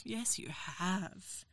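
slow and soft. Acted. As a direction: stretch it out slowly and quietly. Repeated teasing reply, slowed.

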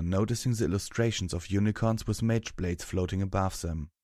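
usual speaking voice

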